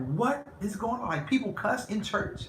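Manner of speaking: high, bewildered disbelief; a little kid who can't believe his ears; wide-eyed child asking in shock. Retold or imitated. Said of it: "and I would be like".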